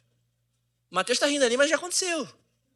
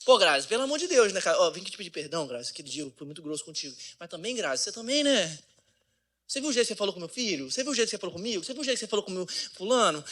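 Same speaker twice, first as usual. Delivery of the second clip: mock exasperated husband; 4 dB quieter, faster than usual. Acted husband's fast accusatory reply.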